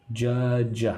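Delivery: "Judge" sounded out slowly and carefully; pronunciation demo. Shown as a demonstration. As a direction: model the word "Judge" for learners, drawing out its sounds. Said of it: "How to say judge?".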